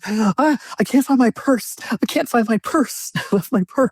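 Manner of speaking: high, frantic wail about the purse; mock panic. Imitating the old woman panicking over her lost purse, high and frantic. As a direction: whine it like it's a disaster. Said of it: "I was".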